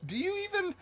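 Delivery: high-pitched, hammed-up outrage; mock indignation. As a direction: act offended, loud and squeaky. Mock outrage at being called pro.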